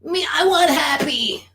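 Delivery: put-on a small child begging to be happy; toddler voice. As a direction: whine like a toddler wanting its way. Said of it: "instead it goes toddler".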